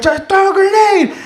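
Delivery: high, yelled repeat order; imitated insistent call. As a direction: holler an impatient order in a firefight. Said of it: "somebody calling me to throw a grenade".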